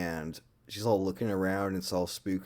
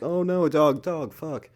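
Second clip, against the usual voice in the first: higher, louder hammed-up fright; mock panic; 9 semitones higher, 8 dB louder than usual. Girl startled by a dog, hammed-up panicked yelp. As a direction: squeal like a scared girl falling in.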